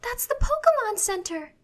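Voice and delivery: very high, loud cry of alarm. A narrator's reading. Serena pointing at the burning building, crying out. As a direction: exclaim it at the top of the voice range. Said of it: "Serena cries out".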